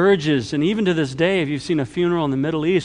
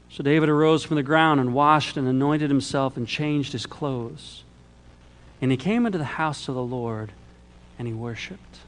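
ordinary talk

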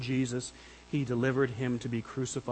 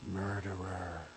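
low, quiet creature voice; Golem's voice; 8 semitones lower, 11 dB quieter, slower than usual. Acted. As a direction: say it low and soft like a cave creature. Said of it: "That was Golem's voice".

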